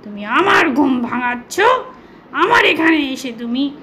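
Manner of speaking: a lightly growled angry lion voice; irritated lion scolding the mouse; roaring lion. Acted. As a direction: play the lion, mildly menacing. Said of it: "সিংহ হুংকার ছেড়ে বলতে লাগলো".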